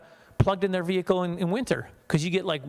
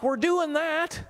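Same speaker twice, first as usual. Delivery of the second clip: mock self; 11 semitones higher, much slower than usual. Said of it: "And I'm like".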